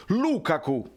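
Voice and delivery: "Lukaku" pitched high as a wrong version; mock mispronunciation. Shown as a demonstration. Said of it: "non l'avete mai chiamato". ("Lukaku" storpiato apposta, in tono alto)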